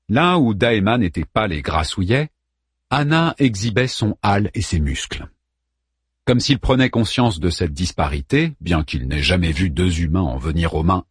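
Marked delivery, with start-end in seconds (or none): none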